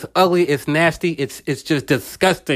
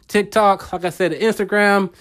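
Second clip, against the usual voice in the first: normal talking voice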